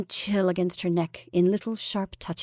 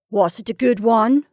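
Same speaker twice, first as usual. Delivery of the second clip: husband's question; 2 semitones higher, 7 dB louder than usual. A narrator's reading. Husband asks wife aloud.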